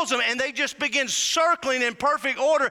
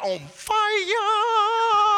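high, long-held cry on "fire"; fired up; 8 semitones higher than usual. Real, not acted. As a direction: stretch "fire" out into a soaring whoop. Preacher acts out getting fired up.

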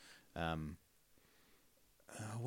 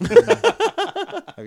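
excited; 10 dB louder than usual. Real, not acted. Excited question about parody musical.

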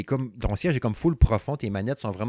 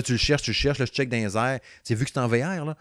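ordinary talk, nothing marked

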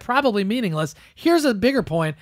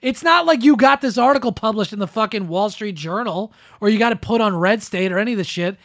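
ordinary talk

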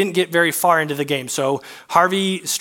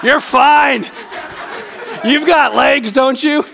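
high, loud mock holler; joking shout; 9 semitones higher, 6 dB louder, slower than usual. Husband jokingly dismissing wife's safety, hammed-up high shout. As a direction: yell it across the lot for laughs. Mock callout to wife left behind.

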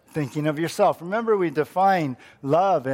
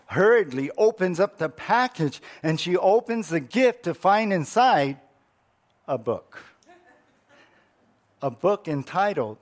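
ordinary delivery

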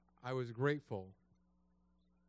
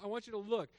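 normal talking voice

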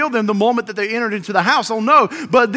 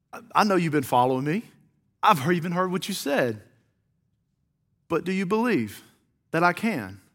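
low, soft voice with long pauses; hushed questioning; 5 semitones lower, 7 dB quieter than usual. Acted. Jesus to the men following him, hushed questioning. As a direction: question them quietly, leaving space.

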